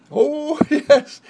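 put-on children giggling their answer; delighted kid laugh; laughing yes.

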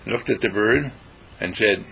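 usual speaking voice

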